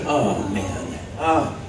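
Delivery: weary reluctance. Retold or imitated. Producer to a latecomer, weary reluctance. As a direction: groan it out, put upon.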